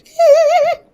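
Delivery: very high, loud operatic hoot; legit singing voice. Shown as a demonstration. Student showing her art-song legit voice, high operatic tone. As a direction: sing out a sustained high classical note. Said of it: "I had to use my ... legit voice".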